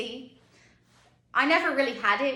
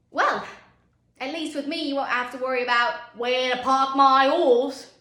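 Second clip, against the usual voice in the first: brash comeback; 8 dB louder than usual. Acted. Candidate joking to a bored interviewer, brash comeback. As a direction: throw the punchline out loud and stretch it.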